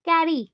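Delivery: "Carry" weighted on कै; stress on first syllable. Shown as a demonstration. Teacher showing first-syllable stress, stressing कै in "carry". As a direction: lean on कै at the start of "carry". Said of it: "first, first में आया".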